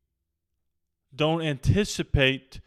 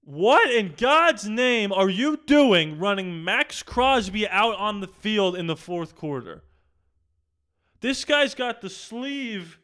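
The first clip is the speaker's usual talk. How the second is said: exasperated yell; 7 semitones higher than usual. Real, not acted. Fan demanding the coach explain himself, high-pitched outrage. Angry question to head coach.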